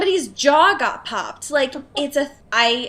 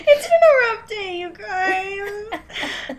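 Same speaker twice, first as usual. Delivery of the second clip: higher, half-crying joke whine; playfully pitiful; mock whimper; 8 semitones higher, much slower than usual. Host to listeners after the brawl story, mock-tearful whine.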